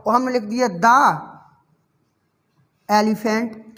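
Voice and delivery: normal talking voice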